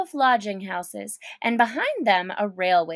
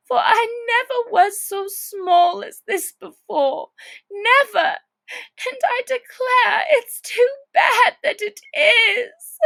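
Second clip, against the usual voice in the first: tearful; 12 semitones higher, 6 dB louder than usual. Acted. Alice despairs at being tiny.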